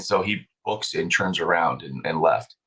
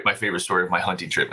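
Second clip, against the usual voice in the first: usual speaking voice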